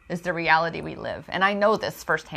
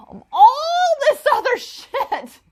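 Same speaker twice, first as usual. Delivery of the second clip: much higher and slower, breaking into a real laugh; amused at her own Pandora's box; 5 dB louder than usual. Real, not acted. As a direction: laugh your way through the swear.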